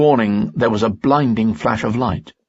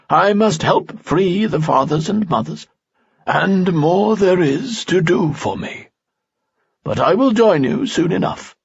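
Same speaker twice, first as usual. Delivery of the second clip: put-on stern old sage; 10 semitones higher than usual. A narrator's reading. Falsafel's voice in story.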